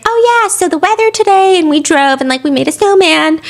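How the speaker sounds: lightly voiced cheerful mother; chatty mom. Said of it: "his mom being like".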